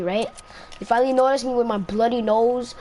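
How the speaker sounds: normal talking voice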